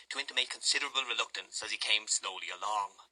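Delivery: usual speaking voice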